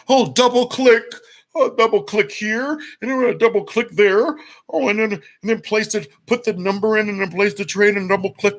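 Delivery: high, silly voice clicking along without a clue; mocking a clueless mouse trader. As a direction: parody a slowpoke fiddling with the mouse.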